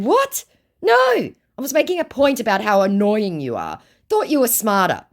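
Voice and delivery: high, exasperated acted retort; mock annoyed. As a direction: start very high, then scold. Acts her annoyed reply.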